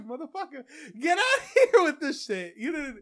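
cracking up while scolding; laughing. Real, not acted. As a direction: laugh through the insult. Laughing retort.

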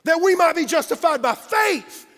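high, loud pulpit proclamation; full-force preaching. Real, not acted. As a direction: preach it big, punching the key words.

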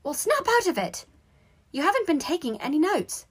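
high, loud, exasperated telling-off; bossy, high-pitched nagging; scolding. A narrator's reading. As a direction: scold a daydreaming classmate. Hermione scolds daydreaming Harry.

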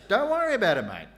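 loud, high Aussie reassurance; Aussie mate. Pretend. Preacher voicing God as an Aussie, loud and cheery. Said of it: "the Aussie version".

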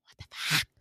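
whispered disbelief; shocked whisper. Retold or imitated. Winter backing away from the villain, shocked whisper.

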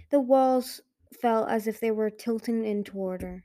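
ordinary delivery

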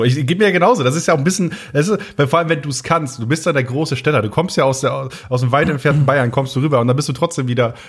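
normal talking voice

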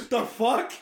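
high-pitched startled reaction; freaked out. Retold or imitated. Co-star reacting to the eye trick, freaked out. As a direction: imitate someone suddenly weirded out. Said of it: "freaked him the fuck out".

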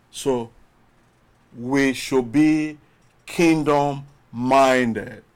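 ordinary delivery

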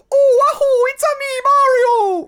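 very high, loud cartoon whoop; Mario. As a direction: squeal it out like an over-the-top game mascot. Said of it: "Chris Pratt has two options".

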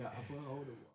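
very soft, stammering confession; sheepish confession. Retold or imitated. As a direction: admit it barely audible. Kid confessing hole in wall.

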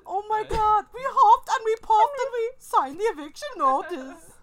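high, loud mock German pig voice; German accent. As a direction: Shrek pigs, German accent. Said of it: "You sound like the pigs from Shrek".

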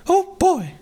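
very high cartoon mouse voice; Mickey Mouse voice. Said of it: "and Mickey is just".

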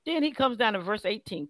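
normal talking voice

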